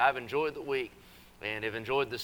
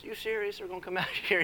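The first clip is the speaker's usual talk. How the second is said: son's eager surprise; 7 semitones higher than usual. Retold or imitated. Little son voiced in a story.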